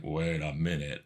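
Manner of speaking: low, slow suspicion; dawning suspicion. Retold or imitated. Players finding the axe missing, slowly suspicious. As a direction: drag it out low and soft, puzzled.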